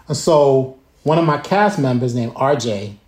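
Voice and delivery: ordinary delivery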